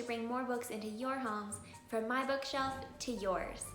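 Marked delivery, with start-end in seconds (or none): none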